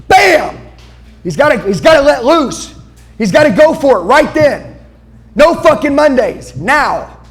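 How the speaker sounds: loud, high, all-out motivational shouting; fired-up preaching. Real, not acted. Speaker urging the audience to act now, full-force shouting. Pumps up seminar crowd.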